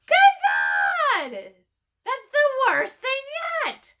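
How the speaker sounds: very high, loud squawked shout; horrified raven shout. Acted. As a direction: scream it in horror as a raven. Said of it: "cried the first raven".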